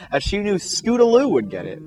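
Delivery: plain reading voice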